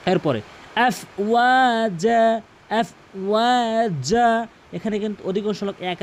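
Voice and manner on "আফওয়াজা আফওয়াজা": আফওয়াজা slow, with long held vowels; stretched afwaja. Shown as a demonstration. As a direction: draw out "waa" and "jaa". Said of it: "এক আলিফ টেনে পড়তে হবে".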